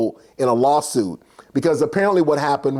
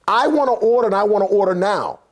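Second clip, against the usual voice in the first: mock-insistent diner, higher at first; acted impatient demand; 3 semitones higher than usual. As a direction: act out a customer demanding service. Impatient diner demanding service in retold scene.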